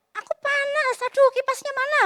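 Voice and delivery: put-on high, fussy kid complaining about the heat; whiny complaining child. Other kid whining loudly for the fan. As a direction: whine like a spoiled hot child. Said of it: "Kan ada anak yang".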